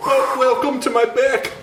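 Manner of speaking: high, slow singing-style impression; John Travolta. Co-host continuing the Travolta bit, high drawn-out impression. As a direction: draw it out high and silly. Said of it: "more of a John Travolta".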